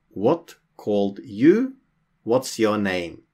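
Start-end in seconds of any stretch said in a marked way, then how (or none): none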